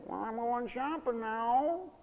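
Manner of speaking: soft, slow sing-song whining goodbye; mock whine. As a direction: whine it out sweetly to needle them. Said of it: "just sort of whine goodbye and say".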